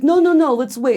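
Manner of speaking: high, loud mock alarm; panicked protest. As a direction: frantically put the brakes on. Protesting wife's plan, acted.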